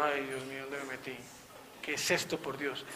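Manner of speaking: a private, stunned aside to himself; hushed dismay. Retold or imitated. Retold shock at prayer group.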